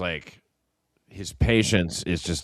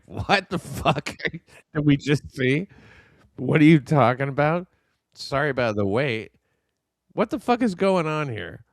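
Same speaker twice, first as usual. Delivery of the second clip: mock Kevin; 7 semitones higher than usual. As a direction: play stunned disbelief, pitched up. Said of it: "Kevin is like, look at his face".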